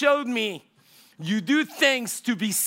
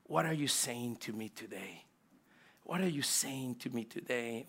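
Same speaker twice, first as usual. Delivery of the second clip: quiet prayer; 7 semitones lower, 10 dB quieter than usual. Shown as a demonstration. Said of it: "sitting with the Heavenly Father and saying".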